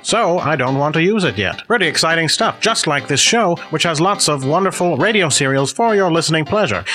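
high, bright 1950s radio host voice; old-timey radio announcer. Acted. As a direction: announce it like a vintage radio serial. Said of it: "this old-timey radio guy".

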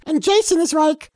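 ordinary delivery

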